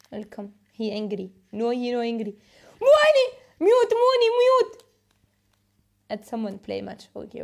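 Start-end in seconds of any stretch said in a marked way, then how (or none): 2.8-4.8 much louder, higher yelled command; shouting. Real, not acted. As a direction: yell at him to mute, right now. Yelling at a noisy guest.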